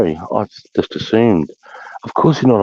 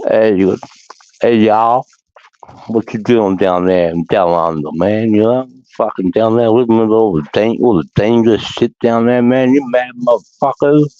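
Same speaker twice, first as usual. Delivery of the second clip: put-on American Southern accent; 5 semitones higher than usual. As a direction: mimic a Southern soldier talking through chewing tobacco. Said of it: "And they talk like this".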